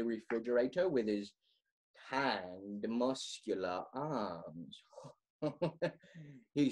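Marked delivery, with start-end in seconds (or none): 2.1-4.8 low, soft, slow swoon over the arms; swooning murmur. Acted. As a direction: savour each word like a lovesick reader.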